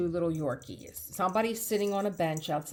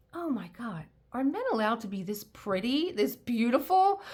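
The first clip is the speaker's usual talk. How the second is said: starstruck; 6 semitones higher, 3 dB louder than usual. Retold or imitated. Thinking while starstruck.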